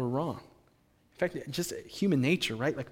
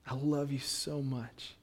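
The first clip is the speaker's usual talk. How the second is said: soft, slow declaration of love; tender; 7 dB quieter, slower than usual. Acted. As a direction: voice God's love gently, drawing it out. Voices God's love for the listener.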